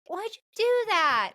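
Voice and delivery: a high, incredulous what the fuck; shocked outburst. Retold or imitated. Reacting to the boy grabbing the girl, high shocked disbelief. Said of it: "I was like".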